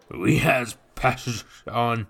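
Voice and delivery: high, loud boozy mockery; loud drunken brute teasing the boy; drunken brute mocking. A narrator's reading. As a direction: tease him like a drunk lout. Nathan voiced again.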